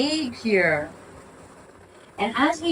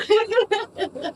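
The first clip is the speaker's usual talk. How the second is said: laughing; 11 semitones higher than usual. Real, not acted. Laughing at the dog.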